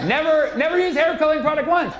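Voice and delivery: high squealed disbelief at never dyeing; emphatic punch. Acted. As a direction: hit it high and loud like a shocked fan.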